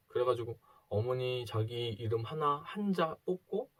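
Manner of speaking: ordinary talk, nothing marked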